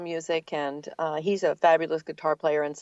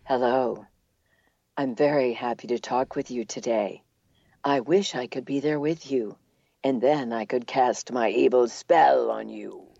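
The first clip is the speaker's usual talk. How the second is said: evil queen; 5 semitones lower than usual. Acted. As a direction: purr it like a wicked queen. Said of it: "how about the evil queen?".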